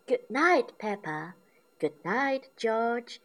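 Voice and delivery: tuck them in sweetly; Mummy Pig's good night. A narrator's reading. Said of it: "says Mummy Pig".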